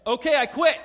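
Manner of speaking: loud, high mock outburst; hammed-up shouted resignation; shouted quit. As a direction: blurt out a cheerful quit. Quitting job on the spot.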